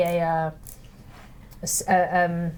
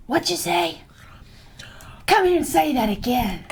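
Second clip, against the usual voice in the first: higher-pitched, put-on catfish voice; challenging catfish; 5 semitones higher than usual. Catfish looks up from his drink at the insult, challenging. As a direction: call the heckler out in a raised character voice.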